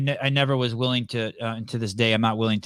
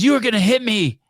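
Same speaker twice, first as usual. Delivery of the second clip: higher mock yell of an angry old man; retold old man yelling; 8 semitones higher, 3 dB louder than usual. Old man at the pump accusing him, a mild mock yell. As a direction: lightly act out the old man shouting. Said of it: "he starts screaming at me".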